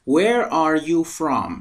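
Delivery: slow, careful English question; slow model reading. Shown as a demonstration. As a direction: model it slowly for repetition. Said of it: "با من تکرار کنید".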